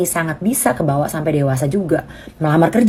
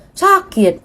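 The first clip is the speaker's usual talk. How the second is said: put-on small child; 12 semitones higher than usual. Child answering parent.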